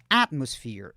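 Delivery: "Atmosphere" with the first syllable punched; stress on first syllable. Shown as a demonstration. Teacher showing students where the tonic syllable is, first-syllable stress on "Atmosphere". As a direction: hit the AT in "Atmosphere", let the rest fall. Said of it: "a sílaba tônica tá na primeira sílaba".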